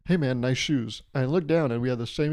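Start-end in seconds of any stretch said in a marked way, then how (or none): none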